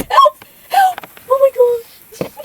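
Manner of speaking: shrieking help, laughing; high shriek. Acted. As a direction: scream for help through laughter.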